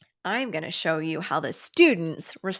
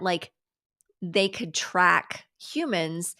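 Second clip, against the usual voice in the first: normal talking voice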